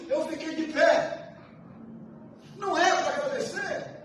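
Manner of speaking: high, loud shouting sermon; triumphant shouted testimony; preaching at full force. Acted. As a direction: proclaim deliverance to the church.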